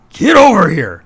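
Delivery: mock Vader yell. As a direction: bark it after the fleeing ship.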